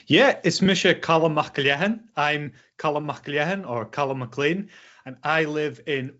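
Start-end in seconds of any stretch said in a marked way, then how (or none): none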